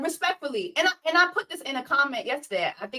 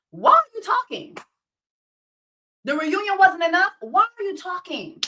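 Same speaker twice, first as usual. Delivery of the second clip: exasperated rant; 7 semitones higher than usual. Real, not acted. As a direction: demand it in disbelief. Ranting at Ashley, exasperated.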